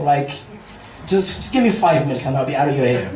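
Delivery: ordinary talk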